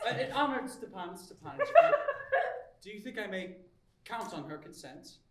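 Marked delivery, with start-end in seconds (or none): 1.7-2.8 high, loud anxious laughter; nervous laugh. Acted. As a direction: laugh out high from sheer nerves. Nervous suitor asks about consent.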